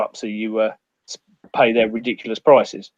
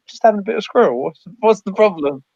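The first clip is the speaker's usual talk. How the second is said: innocent shrug; 6 semitones higher than usual. Retold or imitated. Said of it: "It's like".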